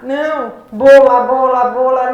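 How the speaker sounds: very loud, hammered repetition; imitated impatient correction, booming; insistent child correcting. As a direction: drum the word in forcefully. Child correcting her failed attempts.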